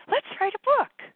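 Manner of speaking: very high, loud, excited little voice. Acted. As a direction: squeak it out like a gleeful inner nudge. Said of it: "this little voice".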